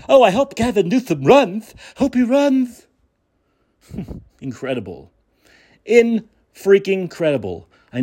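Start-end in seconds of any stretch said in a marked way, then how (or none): 0.1-2.9 high, swooning mock admirer; mock gushing fan. Mocking Newsom fans who want him president, high gushing voice. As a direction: mimic a starry-eyed Democrat hoping he runs. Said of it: "They hope he could be president".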